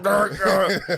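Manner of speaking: high, laughing fake growl; mock growl. Imitating the dying coach, a laughing high growl. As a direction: growl it as a joke while laughing. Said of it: "It's like".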